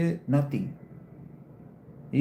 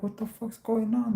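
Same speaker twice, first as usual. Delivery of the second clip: a higher-pitched baffled what-the-fuck; a mock-confused outburst, raised pitch; baffled disbelief; 9 semitones higher than usual. Streamer retelling his phone update, baffled.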